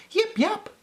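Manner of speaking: squeaky little puppy bark; high puppy yip. A narrator's reading. Storyteller barks as little Peppy, squeaky high yip. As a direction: yip it out high like a tiny pup. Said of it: "barked Peppy".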